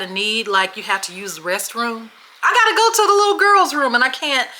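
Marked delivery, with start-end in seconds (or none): 2.4-4.5 put-on high, quick little girl voice. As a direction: squeak it out like a desperate kid. Voicing herself as scared kid.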